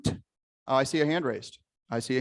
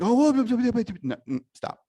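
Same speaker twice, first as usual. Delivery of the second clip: high, loud startled whoa; startled protest; 10 semitones higher, 4 dB louder than usual. Acted. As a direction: recoil in alarm. Acting out a giver's reaction.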